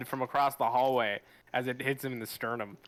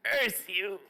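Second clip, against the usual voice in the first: dying last words; 6 semitones higher than usual. Acted. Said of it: "and then he just utters".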